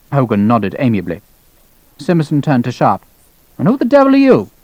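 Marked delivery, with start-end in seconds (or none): 3.6-4.5 high-pitched, indignant challenge; irate colonel. Acted. Colonel challenging an unknown lieutenant, irate. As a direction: demand it from on high.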